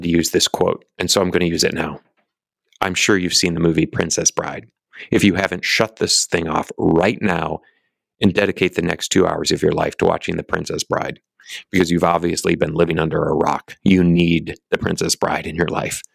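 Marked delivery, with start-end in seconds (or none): none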